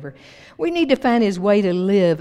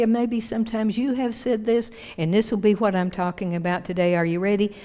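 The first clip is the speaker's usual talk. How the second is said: ordinary talk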